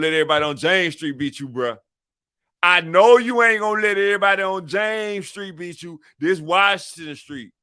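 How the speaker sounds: high, loud hype-man challenge; rallying the team. Acted. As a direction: fire up the block like a rival's coming. Acting out rallying a street team.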